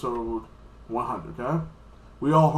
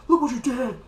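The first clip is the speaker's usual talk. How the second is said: mock accusing shout; 11 semitones higher, 4 dB louder than usual.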